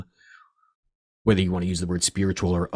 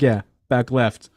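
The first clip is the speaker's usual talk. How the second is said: deadpan reply; 2 semitones higher, 6 dB louder, slower than usual. Retold or imitated. Casual reply to psychic.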